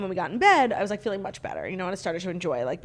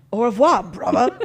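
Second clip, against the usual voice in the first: louder mock French-British greeting; French with bruvva; 6 dB louder than usual. Teasing co-host's accents, loud mock French and British. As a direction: put French first, then British bruvva. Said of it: "you could do French".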